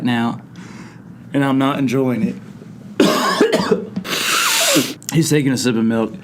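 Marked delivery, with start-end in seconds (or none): 2.4-5.1 choking cough; very high strangled cough. Real, not acted. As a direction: splutter violently. Choking on spicy food.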